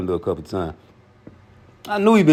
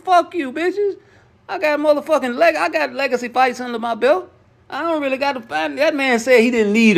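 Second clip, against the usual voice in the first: very high, defiant telling-off; Crawford rant; 14 semitones higher than usual. Retold or imitated. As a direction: cuss them out in a voice pitched far up. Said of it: "if I was, uh, Terrence Crawford".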